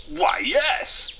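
very high, loud colonel; jovial colonel. Acted. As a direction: answer like a delighted colonel. Colonel answers excited friend.

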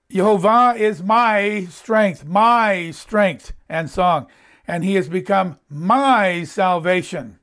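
"my" stretched long and loud each time; stress on MY. Acted. Preacher reading Moses' song to show how personal it is, "my" heavily stressed. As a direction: lean hard and slow on every "my".

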